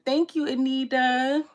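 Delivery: loud, high, slow thank-you; excited thanks. Real, not acted. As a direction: gush over the viewer. Thanking a chat viewer.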